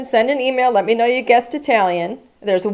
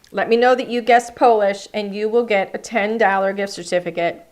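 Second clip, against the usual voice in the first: usual speaking voice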